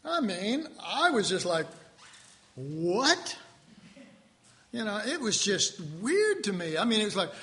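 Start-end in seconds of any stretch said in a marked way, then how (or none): 2.5-3.4 mock baffled, rising "what?". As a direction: act out hearing something incomprehensible. Retelling own baffled first reaction.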